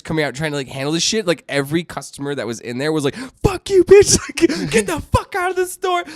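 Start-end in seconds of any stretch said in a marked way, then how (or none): 3.4-6.0 high, loud mock yell at the woman; mock crowd shouting. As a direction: yell her out of the store. Customers shout at wife.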